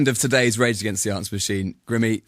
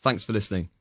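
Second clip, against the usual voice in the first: ordinary delivery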